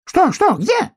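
very high, loud urgent questions; alarmed little animals rushing in; excited questioning. A narrator's reading. Friends rush into the clearing.

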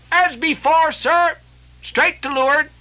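cry from the masthead. Acted. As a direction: shout down from the rigging. Lookout answers from above.

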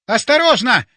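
high, loud shout of alarm; shouted warning. A narrator's reading. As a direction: yell a sudden warning. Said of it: "закричал полковник".

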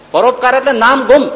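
high, loud proclamation of the moral; emphatic preaching. Real, not acted. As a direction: hammer the point home to the congregation. Preacher drives home the moral.

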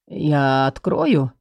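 low, slow and hesitant; unsure. A narrator's reading. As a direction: ask it uncertainly, unsure of yourself. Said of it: "неуверенно спросил дворецкий".

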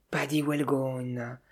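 low, soft and slow, dismayed; muffled repeat. A narrator's reading. Sophie, crushed and dismayed, repeats the name in a low muffled voice. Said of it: "répéta sourdement Sophie".

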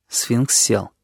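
plain reading voice

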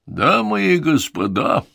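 showman's grand opening call; drawn-out announcer address to the crowd; ringmaster announcing. Acted. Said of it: "объявил конферансье низким голосом".

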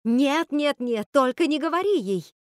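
high, loud pleading protest; urgent shout. A narrator's reading. Wicked sisters trying to stop Rapunzel, urgent shout. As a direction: cry out to stop someone. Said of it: "Злые сёстры закричали".

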